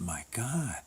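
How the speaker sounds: hushed whisper of amazement; whispered "my God, look at that"; awed whisper. Retold or imitated. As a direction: breathe it out in awe. Awed reaction retold.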